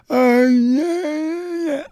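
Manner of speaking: high, drawn-out mock groan of yeah; Ben impression. Host mocking how his co-host sounds, high strained impression. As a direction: strain the word out high, in mockery. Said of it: "'Cause Ben's like".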